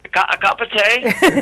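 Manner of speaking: high, slow mock British accent. [name] playing up a British accent for the hosts. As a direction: stretch out a hammy British "cup of tea". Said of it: "I hear you do a fantastic British accent".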